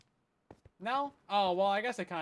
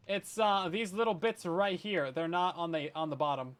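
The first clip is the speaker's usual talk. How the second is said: ordinary delivery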